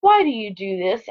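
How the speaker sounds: ordinary talk, nothing marked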